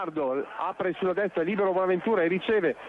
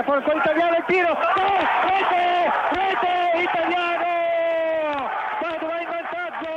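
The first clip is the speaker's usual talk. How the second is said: excited goal call; goal shout; 7 semitones higher, 7 dB louder, slower than usual. Real, not acted. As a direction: roar the goal.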